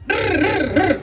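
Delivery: high rolling "drrr"; rolled trill noise. Shown as a demonstration. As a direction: trill it high like a drum roll. Imitated noise after the command.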